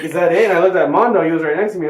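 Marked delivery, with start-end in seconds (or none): 0.0-0.5 high, loud "is that it?"; stunned that the film already ended; incredulous, louder question; disbelief. Retold or imitated.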